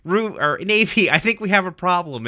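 high, alarmed character voice; Steven. Retold or imitated. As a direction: raise the pitch in mock alarm. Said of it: "And Steven's like".

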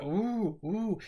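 two short, high hooting "ooh"s; short foghorn hoots. Shown as a demonstration. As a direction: imitate two brief, bouncing hoots. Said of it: "it's not like a".

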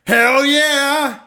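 high, loud, gung-ho shout; enthusiastic hell yeah. Acted. Asked by cohost if he's lucky, answers with a loud excited shout. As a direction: blurt it out like a triumphant yell. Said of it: "Ask me if I'm a lucky guy".

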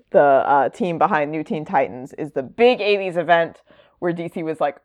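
ordinary talk

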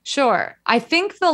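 ordinary talk, nothing marked

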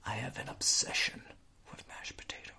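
creepy whisper. Pretend. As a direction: breathe it like a secret obsession. Obsession whispered at potato-hater.

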